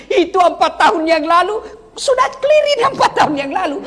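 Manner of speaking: high, mocking voice of a wife digging up old faults; nagging wife. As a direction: whine it up high, half laughing. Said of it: "dia bilang". (suara istri yang mengungkit masalah lama, tinggi dan mengejek)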